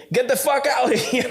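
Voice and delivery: high mock snarl; rude brush-off. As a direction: mimic the girl shooing him off. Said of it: "saying to me".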